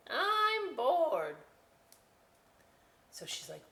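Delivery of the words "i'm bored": much higher, louder bored potato voice. Acted. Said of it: "that's me talking like a potato".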